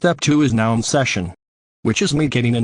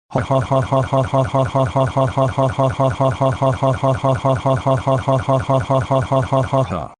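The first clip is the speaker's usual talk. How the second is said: long gloating villain laugh; evil laugh; 3 dB quieter than usual. Acted. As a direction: cackle on and on like a cartoon villain. Villain gloats over plan.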